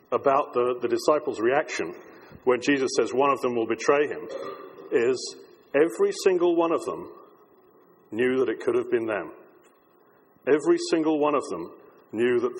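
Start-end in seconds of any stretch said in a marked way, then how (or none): none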